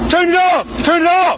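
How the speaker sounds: urgent shout. Real, not acted. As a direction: yell it out over the noise. Officer shouting during incident.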